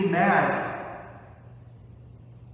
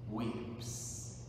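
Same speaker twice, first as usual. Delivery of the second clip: whispered weeps; 3 semitones lower, 11 dB quieter than usual. Real, not acted. Tender hush on weeps.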